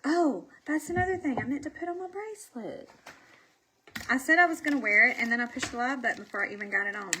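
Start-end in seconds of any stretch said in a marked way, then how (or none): none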